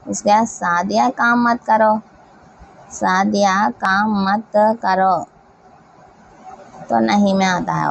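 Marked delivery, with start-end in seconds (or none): 2.9-5.3 slow, measured repeat for the class; drawn-out teacher's dictation. Shown as a demonstration. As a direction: spell it out word by word, slowly. Teacher repeats example slowly for class.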